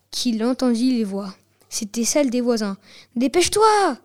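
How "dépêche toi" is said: urgent neighbour's wife. A narrator's reading. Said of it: "disait Madame B".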